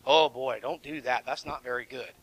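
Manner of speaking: low, soft mock warning; hushed aside. Preacher warns against ignoring a parent's call, hushed aside. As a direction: drop to a quiet, low aside.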